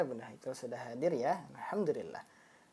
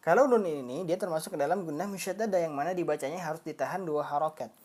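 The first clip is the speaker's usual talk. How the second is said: ordinary talk, nothing marked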